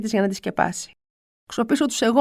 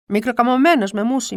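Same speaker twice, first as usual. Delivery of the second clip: ordinary delivery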